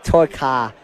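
"car" ending in an open ah, no R; dropped R. Shown as a demonstration. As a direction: say "Toy car" as if unable to make the R. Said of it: "I couldn't pronounce my R's".

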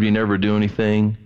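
ordinary talk, nothing marked

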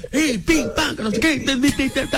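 high-pitched imitation of noisy chatter; mocking high gibberish racket; mock vociferating. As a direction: mimic their babble in a high voice. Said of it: "no se hubiesen puesto a vociferar".

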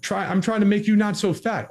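put-on squeaky indignant girlfriend losing it; mock angry outburst. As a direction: fire back at him in a hammed-up fury. Acted escalating fight, her angry reply.

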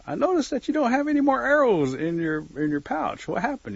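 high-pitched, pointed turkey query; turkey calling back. Acted. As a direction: call it out from a little way off. Turkey taunts from a distance.

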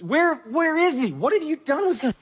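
high, pleading search for him; acted anguish, stretched-out high questions; distraught Mary. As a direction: plead frantically, pitch way up. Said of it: "Again, humanize this".

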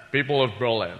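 put-on a Scottish accent as Kennedy orates; lightly done Connery accent; Sean Connery as Kennedy. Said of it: "Sean Connery doing, uh, President Kennedy".

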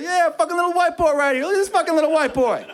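high excited yelling; hyped-up cheering. Retold or imitated. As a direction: cheer him on like a hype man. Teammates hype the lucky shot.

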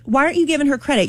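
fast, indignant demand; mad. Retold or imitated. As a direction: fire it off in outrage. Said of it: "I was so mad".

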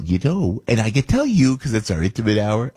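ordinary talk, nothing marked

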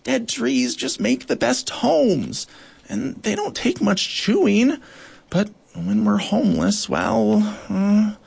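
high, slow pleading; pleading beetle. Acted. Bark beetle pleading with Goliath, high voice. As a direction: explain anxiously, trailing off.

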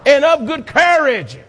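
high, ringing "Be strong"; rallying preacher cry; shouted command. Shown as a demonstration. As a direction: shout it to the congregation.

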